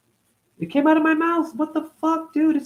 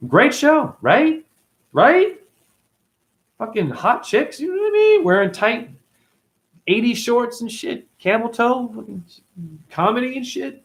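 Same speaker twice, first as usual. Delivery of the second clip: much higher, sing-song camp voice; campy high voice; 11 semitones higher, 3 dB louder than usual. Acted. Ranting about Three's Company in a high campy voice.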